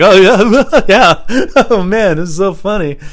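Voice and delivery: loud, high, forced laughter; fake laughing along. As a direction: pretend to be cracking up.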